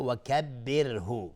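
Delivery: «و کبره» recited with a light R; thin ra. Shown as a demonstration. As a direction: keep the R in «و کبره» thin, never rounded.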